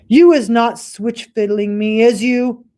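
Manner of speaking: narrow-eyed distrust; suspicious giant. Acted. Suspicious Bloodbottler.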